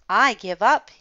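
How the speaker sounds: loud exasperated call; giving up. A narrator's reading. Dinosaur calls out to the hidden children, loud. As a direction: throw up your hands, calling out loud. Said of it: "he said".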